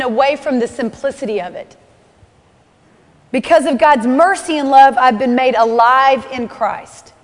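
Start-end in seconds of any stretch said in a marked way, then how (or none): none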